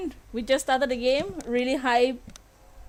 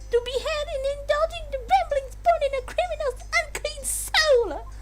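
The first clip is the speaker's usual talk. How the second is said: very high haughty princess voice; evil Peach voice. Acted. Streamer voicing the princess's ruling in an evil high voice. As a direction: sneer it out high as evil royalty. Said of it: "Evil Peach".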